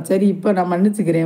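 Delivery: usual speaking voice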